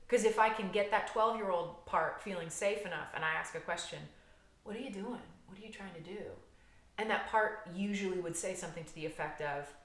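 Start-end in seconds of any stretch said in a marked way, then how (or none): 4.6-6.3 soft, higher, coaxing questions; gentle questioning. Acted. Adult asking a twelve-year-old part, gently curious. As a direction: ask softly so the child feels safe.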